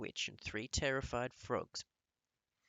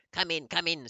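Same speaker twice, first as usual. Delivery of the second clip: loud, impatient snap; snapping witch queen; 6 semitones higher, 11 dB louder than usual. A narrator's reading. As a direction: bark at the dithering witches. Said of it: "snapped the Grand High Witch".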